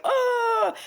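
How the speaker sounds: high, loud yelp Ah; mock scream. Vlogger showing the silly noises viewers may laugh at, squealing. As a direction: blurt a sudden squeal. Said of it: "even if I'm like".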